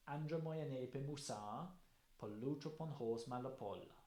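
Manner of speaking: measured hexameter rhythm; Greek verse recital. Shown as a demonstration. Lecturer comparing Homer to Virgil, rhythmic recital. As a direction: recite the Odyssey opening in meter. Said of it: "First line of the Odyssey".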